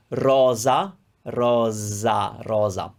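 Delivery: slow, drawn-out "rosa" with a buzzing z; voiced S between vowels. Shown as a demonstration. Teacher modeling S between two vowels, slow "rosa" with z. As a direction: stretch "rosa", s voiced as z, then say it shorter. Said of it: "you will have a Z when the S is between two vowels".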